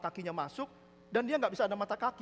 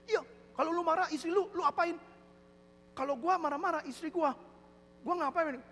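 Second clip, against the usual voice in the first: higher-pitched acted banter; lightly voiced friend; 11 semitones higher than usual. Deaf man asking friend about angry wives, lightly acted.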